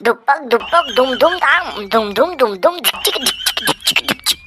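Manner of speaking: mouth-made beat noises, dhuk-pak and jhik-jhik; beatbox a dance track with your mouth; rhythmic vocal drum sounds; beatbox music. Acted. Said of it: "মিউজিকটা আমি দেবো রে".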